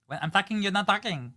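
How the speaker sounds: high, loud mock Spanish accent; scolding in an exaggerated fake Spanish accent. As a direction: imitate her telling-off in a put-on Spanish accent. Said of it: "in the fake accent".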